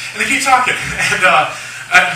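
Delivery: ordinary talk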